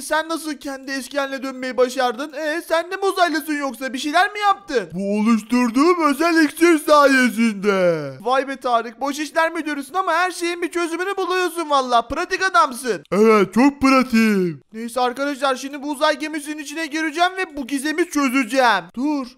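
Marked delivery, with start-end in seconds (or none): 4.9-8.1 proud deep shout. Acted. Tarık voiced proudly. 13.1-14.6 deep proud voice. Acted. Tarık voiced.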